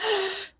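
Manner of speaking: sharp high mock gasp. Retelling her reaction to Mark, a high gasp. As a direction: gasp as if overwhelmed. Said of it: "I'm like".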